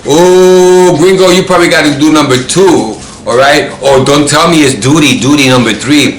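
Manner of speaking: loud, high, mocking singled-out tease; imitating a smart-ass friend; smart-ass teasing. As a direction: tease your buddy loudly like a wise guy. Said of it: "his smart ass".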